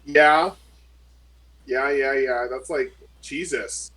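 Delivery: ordinary talk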